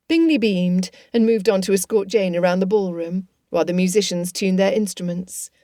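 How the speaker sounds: ordinary delivery, nothing marked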